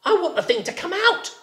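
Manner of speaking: high frightened rabbit; startled rabbit. A narrator's reading. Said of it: "Rabbit said".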